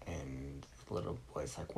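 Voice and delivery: ordinary delivery, nothing marked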